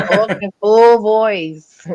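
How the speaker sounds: slow, deliberate Talk in full voice; firm full voice. Real, not acted. Telling investigators to stop whispering, slow and firm. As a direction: lay down the rule slowly.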